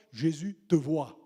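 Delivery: slow, each word weighed; slowed, deliberate refrain; slow emphatic reply. Acted. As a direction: lean on it slowly, with weight. Preacher's repeated answer.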